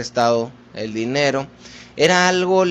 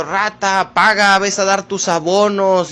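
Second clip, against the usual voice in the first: raised-voice debt collector; higher, mildly shouted demand; mock shouting; 4 semitones higher, 4 dB louder than usual. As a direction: imitate a collector yelling at a debtor. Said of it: "te están gritando".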